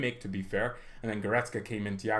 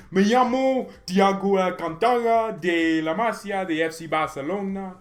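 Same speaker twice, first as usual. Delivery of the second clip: Spanish accent impression; 5 semitones higher, 6 dB louder, slower than usual. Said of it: "I don't know the Spanish accent".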